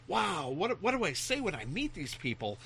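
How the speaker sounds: put-on awed kid. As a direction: wonder aloud like a starstruck kid. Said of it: "'cause I thought".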